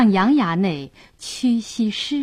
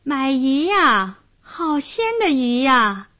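sweet, crisp hawking; 6 semitones higher than usual. A narrator's reading. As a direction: sing out your wares sweetly. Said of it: "一溜甜脆脆的叫卖声".

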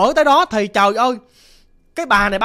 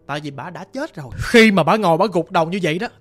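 usual speaking voice

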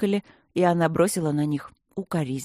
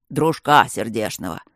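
lower, loud sly explanation; smirking gargoyle; 6 semitones lower, 5 dB louder than usual. A narrator's reading. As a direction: smirk and wink it out. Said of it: "ухмыляясь и подмигивая".

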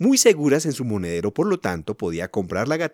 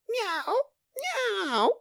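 tiny high-pitched meowing; very high, soft cat cry; kitten's meow; 4 dB quieter than usual. A narrator's reading. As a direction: mew softly like a kitten. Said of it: "la gatita levanta las orejas y dice".

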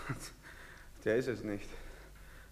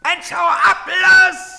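loud, high yell to get lost; shout; 11 semitones higher, 11 dB louder, much slower than usual. Acted. Soldier yelling at the man to clear off, loud shout. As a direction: blast him away.

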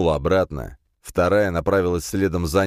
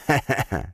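gloating villain laugh; 6 semitones higher than usual. Acted. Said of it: "злорадно засмеялся Дорн".

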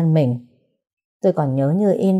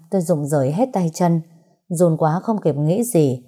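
ordinary delivery

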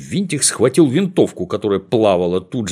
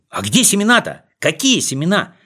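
eager shouting; 7 semitones higher than usual. Acted. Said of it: "наперебой кричали они".

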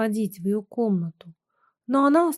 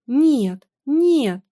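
loud, higher refusal; crying out; 5 semitones higher, 6 dB louder than usual. A narrator's reading. Wife rejects husband's appeal, crying out. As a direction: shout him down in distress. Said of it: "закричала Муся".

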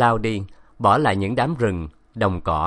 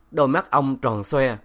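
plain reading voice

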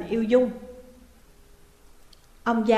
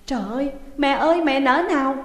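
fast, raised sobbing plea; crying out; 4 semitones higher, 4 dB louder, faster than usual. A narrator's reading. Daughter clinging to her mother's legs, crying out. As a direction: wail at her mother's feet. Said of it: "Dung khóc lớn".

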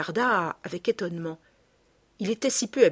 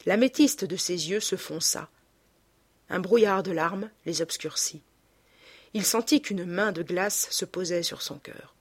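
ordinary delivery, nothing marked

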